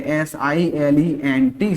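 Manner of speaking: एस आई एल ई एन टी spelled slowly, letter by letter. Shown as a demonstration. Teacher spelling एस आई एल ई एन टी for students. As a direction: spell out एस आई एल ई एन टी for the class. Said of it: "साइलेंट है".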